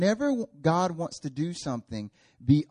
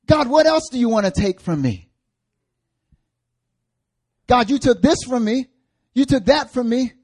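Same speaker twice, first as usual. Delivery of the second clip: loud, high, wounded protest to God; acted pleading indignation; aggrieved complaint; 10 semitones higher, 9 dB louder than usual. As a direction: cry out against the unfairness, building higher. Acts out believer's aggrieved complaint to God.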